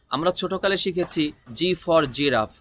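ordinary delivery

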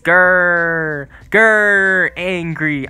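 playful drawn-out "grr" of pretend anger; mock angry growl. Player begging his rival to send a blimp, mock angry. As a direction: growl with hammed-up, not real, anger. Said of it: "You hear the angerness in my voice".

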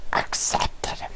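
breathy whisper; whispered accepted. Real, not acted. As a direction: drop to a whisper.

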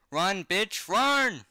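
high, loud shout to flee; rising, drawn-out holler; urgent yell. Real, not acted. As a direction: yell at someone to escape, pitch climbing. Urging the story's character to flee.

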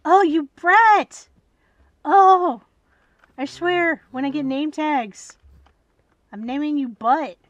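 high, loud mock threat; loud scolding. Real, not acted. Gamer scolding cow on her bed, loud scolding.

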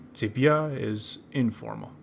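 ordinary talk, nothing marked

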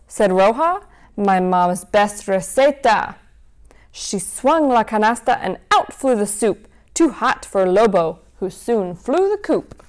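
lively storybook read-aloud; reading to children. A narrator's reading. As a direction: read it like a bedtime story for kids. Reads picture book to children.

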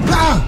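high loud cry of fright; startled scream. Real, not acted. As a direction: yelp as it appears. Monster appears.

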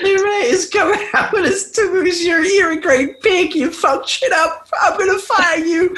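high-pitched words squeezed through laughter; slow, giggle-choked delivery; helpless giggles. Real, not acted. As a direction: try to read on while cracking up. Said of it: "sorry, I've got the giggles".

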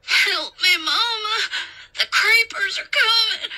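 put-on high, wailing child voice; shrill terror, near crying; panicked boy screaming. A narrator's reading. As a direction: shriek for help like a terrified boy.

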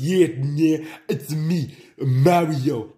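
bad, high-pitched video-game hero impression; Mario. Amateur actor introduces himself in the play, bad Mario impression. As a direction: attempt the plumber's catchphrase, badly. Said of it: "You guys sound nothing like them".

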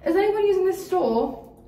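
high-pitched imitation British accent; mock British accent, high and lilting. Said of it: "these girls come in with a British accent".

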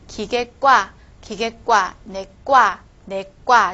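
"挂" in "기계挂" and "내挂" hit as a hard tense kw; loud, heavy "挂" ending "기계挂", "내挂"; tensed consonant. Shown as a demonstration. Teacher giving examples to students, "挂" in "기계挂" and "내挂" tensed hard. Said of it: "要把这个夸变成重音".

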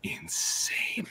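slow, whispered anticipation; awed whisper. Retold or imitated. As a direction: breathe it out in hushed awe. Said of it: "I was like".

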